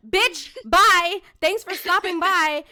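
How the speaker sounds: loud retold brush-off to a rude viewer; loud sassy dismissal. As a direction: shout the brush-off with attitude. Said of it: "I was like".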